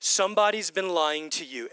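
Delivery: normal talking voice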